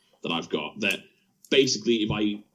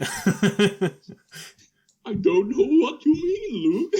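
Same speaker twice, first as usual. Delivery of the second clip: higher, slow, sly denial breaking into laughter; put-on co-host voice cracking up; 12 semitones higher, 3 dB louder, much slower than usual. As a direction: play innocent in the character voice until the laugh slips out. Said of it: "let's hear".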